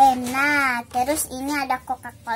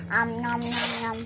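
playful pretend munching; nom nom chomping. Pretend eating toy food.

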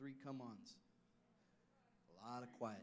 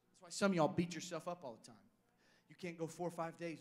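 louder, pitched up, driving the point home; higher, louder sermon emphasis; emphatic preaching; 5 semitones higher, 6 dB louder than usual. Real, not acted. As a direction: preach it out strong to the congregation.

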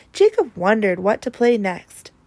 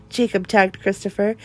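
usual speaking voice

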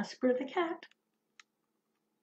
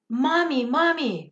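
high, loud call for mommy; scared children calling out in the storm; frightened cry; 6 semitones higher, 5 dB louder than usual. Acted. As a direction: twins woken by thunder, crying out loud for mom. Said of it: "they cried".

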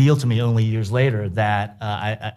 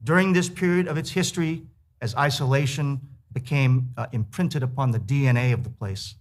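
reading aloud; 4 semitones higher than usual. A narrator's reading. Author reads his essay aloud.

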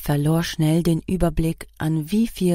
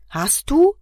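much higher, louder repeated demand; aggressive demand; 10 semitones higher, 6 dB louder than usual. A narrator's reading. Driver insisting his passenger answer, aggressive demand. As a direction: bark the question at her. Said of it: "fragte Antonio beinahe aggressiv".

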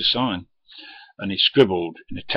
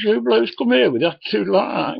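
high, louder huffy complaint; mocking indignant bloke; 13 semitones higher, 7 dB louder than usual. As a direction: whine it like an offended customer. Bloke complains about drivers.

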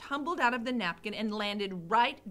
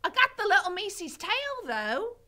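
higher, louder, fast boast; proud Mig; 10 semitones higher, 6 dB louder than usual. Acted. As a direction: show off the tail. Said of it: "said Mig".

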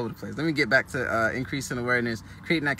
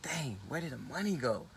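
quiet, puzzled muttered aside; softly surprised at himself; a hushed moment of money panic; dismayed aside; 2 semitones lower, 11 dB quieter than usual. Retold or imitated. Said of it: "I was like".

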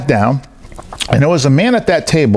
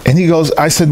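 usual speaking voice

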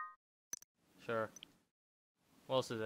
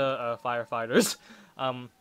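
ordinary talk, nothing marked

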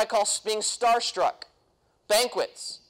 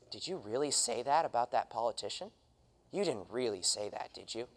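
much softer, hushed disbelieving question; a scandalized neighbor leaning in, much softer; shocked neighbor; 11 semitones lower, 11 dB quieter than usual. Retold or imitated. Neighbor confronting the activist about a remark, hushed and much softer. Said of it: "going up to you and saying".

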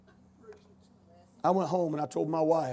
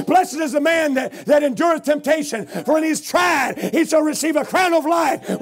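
loud, high scripture thundered out; preaching at full force; 8 semitones higher, 6 dB louder than usual. Acted. Preacher thundering scripture at the doctor. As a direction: preach it like a revival.

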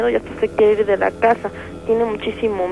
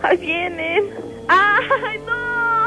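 high, tearful panic that climbs higher; panicked crying; 9 semitones higher, slower than usual. Acted. Possessed caller crying to the host that they come, high panicked sobbing. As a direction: sob out that they are coming. Caller sees the shadows arriving.

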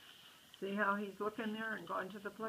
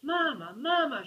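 loud, high upset cry for Mama; shouting for mama; 7 semitones higher, 9 dB louder than usual. Acted. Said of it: "she shouted".

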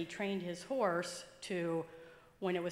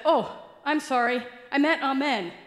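frantic correction; 4 semitones higher, 9 dB louder than usual. Retold or imitated. Preacher corrects himself in panic.

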